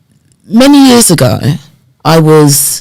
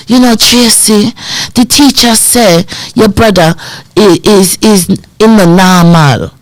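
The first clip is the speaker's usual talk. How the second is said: put-on strong Jamaican accent, pitched up; mum's Jamaican accent; 3 semitones higher than usual. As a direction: imitate a Jamaican mother talking to her child. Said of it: "she had a strong Jamaican accent".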